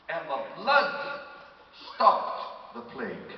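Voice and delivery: loud, slow, emphatic sermon delivery; forceful preaching. Real, not acted. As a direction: drive each phrase home to the congregation.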